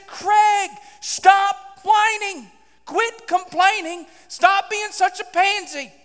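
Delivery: high, loud, slow, spaced-out scolding; stern rebuke. Retold or imitated. The Lord telling Craig to quit complaining, stern rebuke. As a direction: hammer each command home slowly. God's rebuke, acted by preacher.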